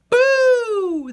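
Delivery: high, loud boo; loud holler. Acted. Duck and Goose jump out, loud scary boo. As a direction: shout it to scare. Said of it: "they hollered together".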